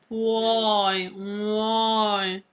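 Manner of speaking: "Puoi" with U, O, I each heard; three vowels. Shown as a demonstration. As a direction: roll "uoi" like a wheel. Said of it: "we need to hear the three".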